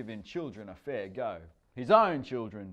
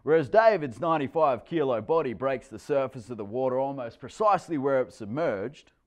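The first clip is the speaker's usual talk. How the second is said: ordinary delivery, nothing marked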